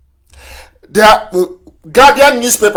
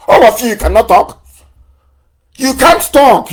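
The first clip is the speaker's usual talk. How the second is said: sharply raised, higher and louder; scornful raised voice; 6 semitones higher, 4 dB louder than usual. Real, not acted. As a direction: hurl it at them as an accusation. Berating listeners as cowards.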